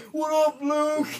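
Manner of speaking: high mocking replay of the call; mock greeting. Comedian replaying the wrong-name greeting, high. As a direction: echo his greeting for the punchline.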